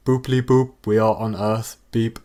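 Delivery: beeping like a robot; rehearsed computer noises; fake robot voice. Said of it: "very rehearsed computer noises".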